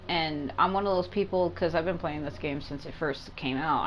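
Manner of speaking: ordinary delivery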